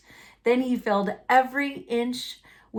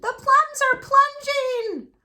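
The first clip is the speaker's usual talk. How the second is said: boomed; 4 dB louder than usual. A narrator's reading. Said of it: "boomed my brothers".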